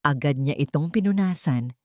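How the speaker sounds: plain reading voice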